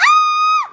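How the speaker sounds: sudden high loud scream. Acted. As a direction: shriek in shock. Mother vanishes, scream.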